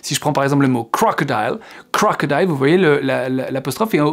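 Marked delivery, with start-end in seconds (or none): 0.8-1.6 crocodile with the weight on CRO; stress on CRO. Shown as a demonstration. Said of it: "c'est cro qui va être, euh, accentué". 1.9-2.5 crocodile stressed on CRO; CRO-codile, first syllable hit. Shown as a demonstration. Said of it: "c'est cro qui va être, euh, accentué".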